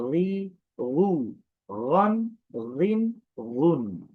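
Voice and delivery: রি, রু said slow and separate; teacher spells out each vowel form; slow vowel drill. Shown as a demonstration. Teacher drilling students on vowel forms, slow. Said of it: "যদি বিভিন্ন উচ্চারণ দেখি".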